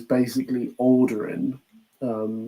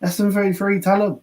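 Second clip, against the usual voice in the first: higher-pitched imitated plea; urgent appeal; 7 semitones higher, much faster than usual. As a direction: mimic someone appealing for backup, higher and hurried. Acting out crew pleading with robot.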